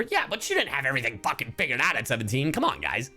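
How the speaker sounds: usual speaking voice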